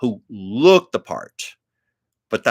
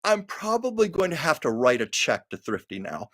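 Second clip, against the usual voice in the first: laughing quote; 6 semitones higher than usual. Retold or imitated. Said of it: "And then Carol said".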